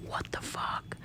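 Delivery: breathless, whispered disbelief; shocked whisper. Retold or imitated. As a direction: mouth it in stunned disbelief. Zoro shocked at his captain.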